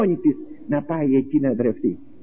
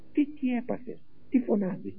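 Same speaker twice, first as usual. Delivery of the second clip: puzzled question; 7 semitones higher, 9 dB quieter than usual. Acted. Philip answering the shouting friend.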